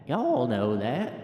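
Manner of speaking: slow, stretched "Y'all know that"; drawn-out repeat. Acted. Folksy voter checking with listeners, slow drawn-out "Y'all know that". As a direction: drag out every word of "Y'all know that".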